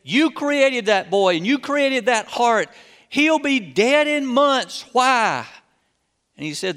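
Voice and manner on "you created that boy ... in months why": loud, higher, slow furious protest at God; angry outcry. A narrator's reading. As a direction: hurl it at heaven, rising to a shout. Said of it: "And in anger and aloud".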